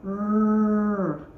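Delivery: R said as a short growled rrr; letter sound R. Shown as a demonstration. Teacher isolating the sound R for kids, a short rrr. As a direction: give just the sound R, rrr. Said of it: "What sound does the word red begins with".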